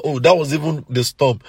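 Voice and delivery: ordinary talk, nothing marked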